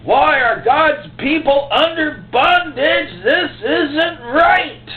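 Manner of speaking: slow, high, loud lament with a break of crying; Gideon's anguished cry. Acted. Gideon crying out over God's people, slow anguished lament. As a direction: wail it out slowly, heartbroken. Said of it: "the kind of cry that Gideon had".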